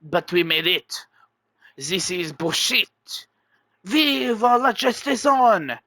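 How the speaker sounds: put-on a light French accent on the outraged claim; lightly voiced Frenchman protesting. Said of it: "exclaims in a French accent".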